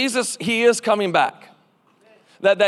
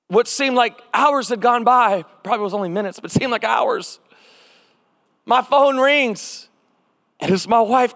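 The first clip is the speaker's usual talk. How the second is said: ordinary talk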